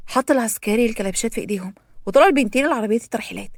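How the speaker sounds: plain reading voice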